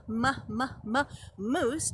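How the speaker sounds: "moose" after separate, repeated "m" sounds; first sound repeated. Shown as a demonstration. Teacher sounding out a word for preschoolers, first sound repeated. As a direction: bounce on the m before saying "moose". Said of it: "M words".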